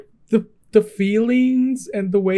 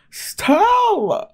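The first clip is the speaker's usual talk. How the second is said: the name squealed much higher, falling away; fan squeal; 14 semitones higher than usual. Real, not acted. Squealing his name, fan reaction.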